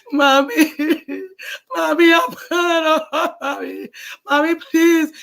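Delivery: high, loud sobbing plea; crying plea. Acted. Burned child begs her mother, high loud crying plea. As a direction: wail for mommy in pain.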